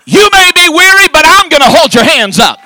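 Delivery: loud, high, chanting sermon cadence with "uh" breaths; preacher hammering home victory to the congregation; full-force preaching. Acted. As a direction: whoop it up to the whole church.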